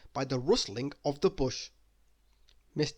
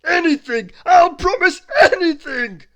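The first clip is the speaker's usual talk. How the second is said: high, very loud begging; frantic dragon pleading; 15 dB louder than usual. Acted. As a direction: dragon desperate for the tickling to stop. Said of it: "pleaded the dragon".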